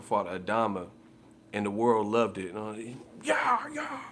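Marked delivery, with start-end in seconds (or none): 3.2-4.0 much higher whispered shout; squeaky mock dismissal. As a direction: hiss them out in a squeaky falsetto.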